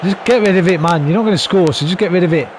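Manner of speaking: loud, high pleading yell to clear the ball; urgent shout. Real, not acted. Manager shouting at his defenders to clear, loud and high. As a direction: scream at them to boot it away.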